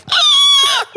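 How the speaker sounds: very high, loud shriek; terrified scream. Retold or imitated. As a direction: let out a panicked kid's scream. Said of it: "Kid just fucking".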